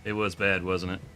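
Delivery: sympathetic to the animal. Real, not acted.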